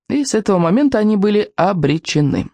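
plain reading voice

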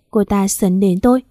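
usual speaking voice

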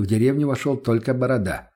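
plain reading voice

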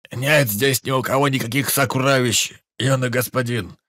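wheezing hoarsely from the floor; hoarse wheeze. Acted. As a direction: rasp it out, beaten and hurt. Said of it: "прохрипел с пола Ауян".

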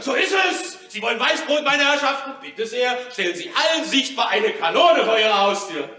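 high, exultant mock address to the gentlemen; triumphant. Gloating at the government after winning the cannons, exultant. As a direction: crow it out, gloating and elated.

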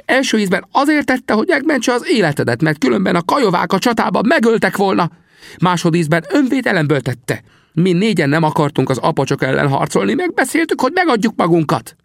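very high, urgent character voice; high-voiced, earnest protesting; insistent Sam, pleading a case. A narrator's reading. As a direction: argue the defence eagerly, pitched high.

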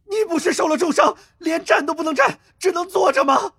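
very high-pitched furious outburst; enraged roar. A narrator's reading. Attacker whose knife was caught, enraged roar. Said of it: "行风怒吼".

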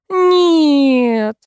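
long, high moaned refusal; loud, drawn-out wail sliding downward; groaning. A narrator's reading. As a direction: groan it out in dismay. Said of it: "простонала я".

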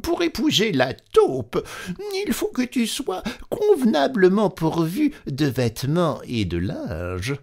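very high squeaky mouse voice. A narrator's reading. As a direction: pinch the voice up into a small mouse. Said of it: "la souris". (voix de souris très aiguë)

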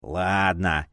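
low, grudging grunt; displeased grumble. A narrator's reading. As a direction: grumble your consent, low. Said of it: "недовольно буркнул".

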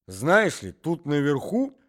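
put-on a wheezy old man dragging the word out; old man's voice. Old man talks through coughing.

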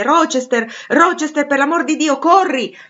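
high, loud cry for help; desperate shout. A narrator's reading. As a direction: shout for rescue with rising urgency. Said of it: "distinsi queste parole". (grido disperato e concitato)

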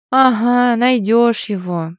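loud, drawn-out lament; wistful drawl. A narrator's reading. Friend on finding a husband, wistfully drawn out. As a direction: stretch the words out in mock despair. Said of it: "с тоской протянула подруга".

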